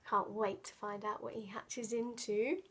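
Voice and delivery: ordinary delivery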